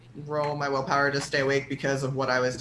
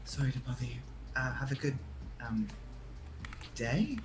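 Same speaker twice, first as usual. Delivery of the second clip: soft, hesitant apology in character; hushed; 3 semitones lower, 10 dB quieter than usual. Acted. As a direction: keep it low, as if peeking out of a doorway. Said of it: "he kind of whispers".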